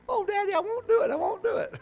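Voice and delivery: very high, tearful little-girl imitation; mock crying daughter. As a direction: plead in a squeaky fake cry. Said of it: "she'd be in over corner crying".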